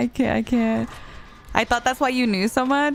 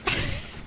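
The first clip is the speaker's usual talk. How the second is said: high giggle; 7 dB quieter than usual. Real, not acted. Laughs at chat.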